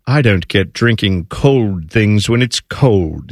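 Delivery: slow, low English caricature; mock English accent. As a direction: drag out a put-on English complaint. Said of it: "how English people are like".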